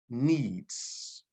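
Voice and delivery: Needs. whispered with no voice, falling. Shown as a demonstration. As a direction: breathe the word Needs. as a whisper. Repeating a key word twice.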